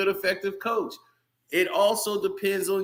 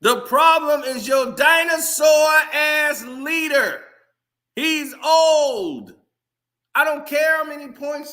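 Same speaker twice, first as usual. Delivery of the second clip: angry; 9 semitones higher, 5 dB louder than usual. Real, not acted. Fan rant about LeBron.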